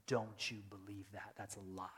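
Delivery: whispered rebuke; Paul whispers to a doubter; urgent whisper. Retold or imitated. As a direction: hush it like a secret warning. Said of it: "Paul comes in hard".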